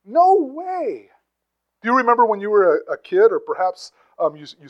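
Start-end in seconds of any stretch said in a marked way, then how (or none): 0.0-1.1 high, loud, insistent refusal; an exaggerated, emphatic no way. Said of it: "it's the emphatic".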